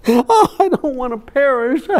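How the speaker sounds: put-on high, wailing little-girl voice, half sob and half laugh; imitating a scared little girl who bursts into tears; child crying. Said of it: "And she started crying".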